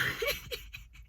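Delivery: airy squeak of laughter; breathy high giggle. Real, not acted. Laughing at herself.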